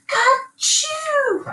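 very high squealing "Ka-choo"; squealed sneeze. A narrator's reading. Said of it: "the piglets squealed".

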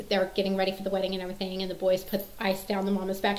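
ordinary delivery

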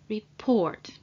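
report, stressed on PORT; second-syllable stress. Shown as a demonstration. Teacher shows the stress in report, on the second syllable. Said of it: "the stress is on the second part of the word".

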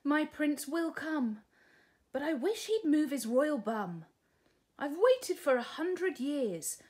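a hint of a bored young princess; sighing princess. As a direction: princess grumbling from her tower. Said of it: "she sighed".

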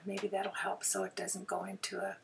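sparing a sore throat, softly. Real, not acted. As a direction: ease off and speak softly to spare the throat. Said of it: "I'm gonna try talk softer".

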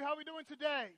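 loud, high call to the crowd; rousing greeting. Real, not acted. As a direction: pump up the room with a big hello. Pastor greets the congregation loudly.

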